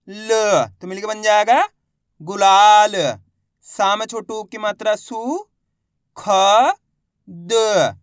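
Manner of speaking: slow, loud spelling chant with stretched syllables; letter-by-letter reading. Shown as a demonstration. Teacher modelling word-building for pupils, letter by letter. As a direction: sound out each syllable, pause, then blend loudly. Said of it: "अपन एक एक करके शब्दों को पढ़ेंगे".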